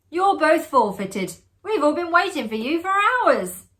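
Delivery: loud, high calling back and forth. A narrator's reading. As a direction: call across the dark room. Shouted exchange across the room.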